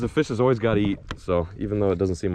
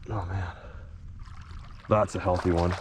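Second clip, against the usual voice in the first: usual speaking voice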